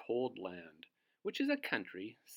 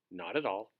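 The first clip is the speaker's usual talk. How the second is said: ordinary delivery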